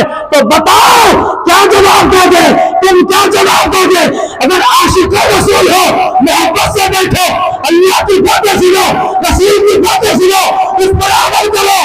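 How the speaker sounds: high, strained, impassioned sermon cry; full-force preaching. Acted. Preacher urging devotees to listen to Allah's word, full-force preaching.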